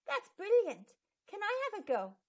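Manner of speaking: wide-eyed wonder, begging a turn; amazed delight. Acted. Pip amazed by the sparkling drawing.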